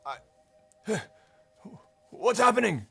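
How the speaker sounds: sharp, high, loud challenge; startled guard. Acted. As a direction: call out like a surprised guard.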